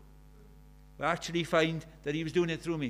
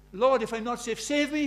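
louder, higher cry for rescue; desperate plea; 6 semitones higher, 8 dB louder than usual. Retold or imitated. Young believer pleading with the Lord, desperate and loud. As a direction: beg as if afraid you're lost. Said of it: "desperate, absolutely desperate".

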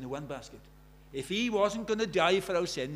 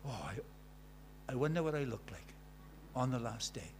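hushed musing; 3 semitones lower, 8 dB quieter than usual. Acted. Quiet wondering aside.

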